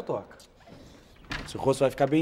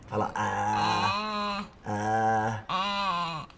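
tongue out, saying ah; tongue depressor; 4 semitones higher, much slower than usual. Acted. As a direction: mouth held open for a throat check. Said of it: "Põe a língua pra fora".

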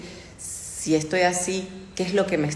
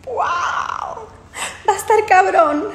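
high laughing whoop, much louder; laughing shout; 13 semitones higher, 6 dB louder, slower than usual. Real, not acted. To her fans, about their mother choosing: laughing shout.

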